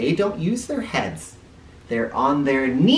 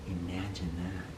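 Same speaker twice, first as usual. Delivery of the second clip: hushed wonder; 6 semitones lower, 13 dB quieter than usual. Acted. Wondering aside to listening kids.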